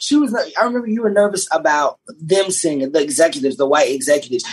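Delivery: normal talking voice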